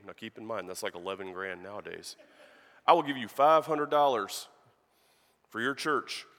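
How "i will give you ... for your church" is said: louder, pressing offer that stresses the five hundred dollars; a cowboy insisting to the preacher, loud; insistent offer. Retold or imitated. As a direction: push the offer hard. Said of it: "he says".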